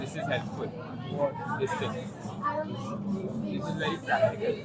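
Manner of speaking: very high, soft squeak; tiny breathy squeaky voice; squeaky whisper. Pretend.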